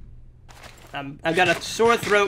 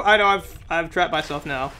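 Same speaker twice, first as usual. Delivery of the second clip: usual speaking voice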